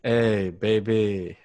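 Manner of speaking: very slow, low come-on; sexy deep voice. Retold or imitated. Co-host mimicking the allergy voice, sexy deep voice. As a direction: drag it out deep and seductive. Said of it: "my sexy, deep voice".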